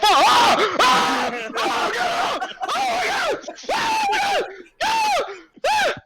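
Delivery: shrill, very high terrified screams; panicked screaming. Acted. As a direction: scream like a bear has your ankle. Man bitten by bear screaming.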